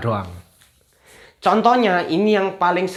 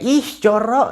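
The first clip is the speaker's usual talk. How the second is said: higher, drawn "ih" of yuck; mock disgust; 8 semitones higher than usual. Teacher likening the sound to disgust, mock yuck. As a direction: say it like recoiling from dirt. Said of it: "nah gitu".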